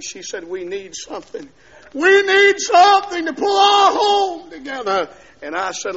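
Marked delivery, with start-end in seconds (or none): 1.9-5.1 high, loud, slow wail of a plea; shouted plea. Retold or imitated. Wife pleading to save the marriage, shouting. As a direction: holler it out, stretching the key words.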